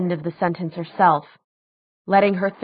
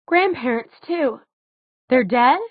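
high, halting, rising to a question; voice catching; 7 semitones higher than usual. A narrator's reading. Daughter asks after lost family, voice catching. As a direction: let it catch, then ask higher. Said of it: "her voice catching".

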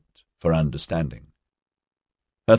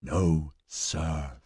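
soft, husky stage whisper; deliberately hoarse whisper; 2 semitones lower, 9 dB quieter than usual. A narrator's reading. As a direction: drop to a mock-spooky hoarse murmur. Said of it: "His voice dropped to a deliberately hoarse whisper".

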